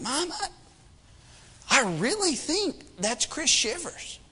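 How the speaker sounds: acted kid insisting under his breath; hushed insistence. Boy trying to convince his dismissive mom, hushed and insistent.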